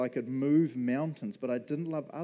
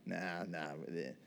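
dismissive brush-off; 7 semitones lower, 9 dB quieter than usual. Retold or imitated. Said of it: "Nigel could have been like".

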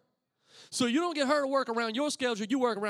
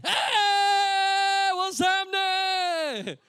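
stretched, loud greeting across the room; loud call out; 8 semitones higher, 8 dB louder, much slower than usual. Retold or imitated. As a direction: call out slowly and loudly, showing off. Calling out loudly across room.

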